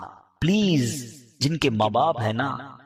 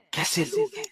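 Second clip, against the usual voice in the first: appalled; 3 dB quieter than usual. Real, not acted. Preacher appalled at cruel children.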